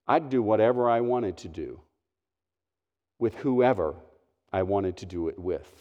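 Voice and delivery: low, soft and sultry; seductive sultry voice. Retold or imitated. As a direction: purr it out seductively, quietly. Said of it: "in a rather seductive and sultry voice".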